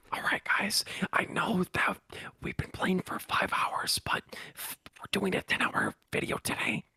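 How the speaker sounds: soft ASMR streamer whisper. Pretend. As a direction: whisper to the viewers. Continuing ASMR whisper gag.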